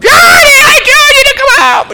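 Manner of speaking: high, loud exasperated holler; angry yell. Retold or imitated. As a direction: shout it, fed up now. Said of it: "She yelled and hollered".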